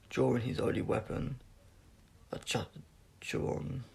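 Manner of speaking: ordinary delivery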